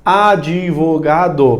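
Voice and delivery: "Advogado" very slow, in five stretched blocks; syllable by syllable. Shown as a demonstration. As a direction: sound out "Advogado" as a-di-vo-ga-do. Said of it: "eu pronunciei cinco sílabas".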